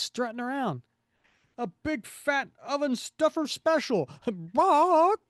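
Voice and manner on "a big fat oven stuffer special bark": high, louder goofy character voice; silly cartoon voice. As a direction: ham it up like a cartoon bird. Character voice in comic reading.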